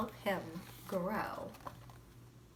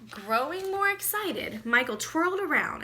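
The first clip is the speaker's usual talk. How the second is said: lifted, louder storyteller voice; excited narration; 7 semitones higher, 8 dB louder than usual. A narrator's reading. Teacher reading to the class, excitement building. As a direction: read it with rising excitement.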